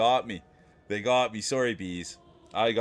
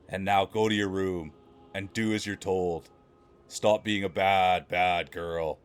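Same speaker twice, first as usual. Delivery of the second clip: saucy book narration; 5 semitones lower than usual. Acted. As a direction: read it like a steamy romance audiobook. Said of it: "I'll have to work on the growl".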